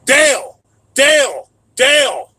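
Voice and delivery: loud repeated yell of a name; yelling three times. Retold or imitated. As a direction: shout the name across the room. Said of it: "Cornelius yells "Dale" three times".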